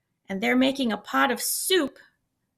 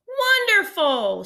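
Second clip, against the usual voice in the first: high, slow, louder cheer; delighted cry to the crowd; joyful drawn-out shout; 10 semitones higher, 6 dB louder, slower than usual. A narrator's reading. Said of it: "shouted the travelers".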